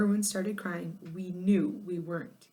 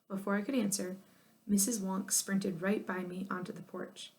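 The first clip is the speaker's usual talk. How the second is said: plain reading voice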